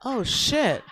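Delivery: ordinary talk, nothing marked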